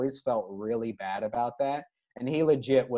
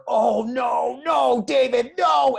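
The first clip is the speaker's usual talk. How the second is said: high, loud panicked shout of no; coach yelling in alarm; 10 semitones higher, 7 dB louder, much slower than usual. Retold or imitated. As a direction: yell it like a startled coach watching disaster. Coach yelling as boy wets himself.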